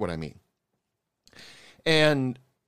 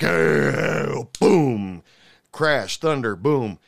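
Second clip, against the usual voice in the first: louder shouted boom and crash; mock explosion; 2 semitones higher, 5 dB louder, much slower than usual. Narrator mimics the blowup when the owner is caught, a loud mock explosion. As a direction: boom it out like thunder.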